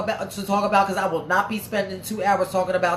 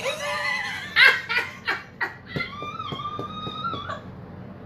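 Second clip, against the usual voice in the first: shrieking laugh; 5 dB quieter than usual. Real, not acted. Reacting to a viewer comment.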